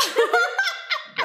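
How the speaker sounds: high squealing laugh; real high-pitched laughing fit; burst of laughter. Real, not acted. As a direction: break into a squeal. Laughing at ASMR dad joke.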